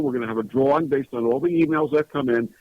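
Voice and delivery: ordinary talk, nothing marked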